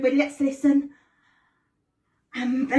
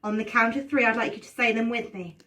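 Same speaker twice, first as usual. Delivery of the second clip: put-on kids party magician; 3 semitones lower, 3 dB louder than usual. Storytelling class host voice.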